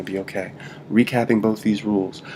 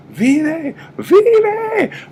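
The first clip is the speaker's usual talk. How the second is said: much higher, loud desperate cry of "vive"; mock scream; 9 dB louder than usual. Shown as a demonstration. Teacher hamming up a CPR scream at the patient. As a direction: scream it like saving a life. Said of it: "you're screaming out to them".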